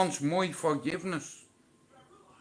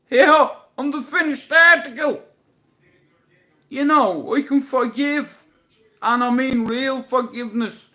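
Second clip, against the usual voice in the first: triumphant; 10 semitones higher, 9 dB louder than usual. Real, not acted. Proud declaration.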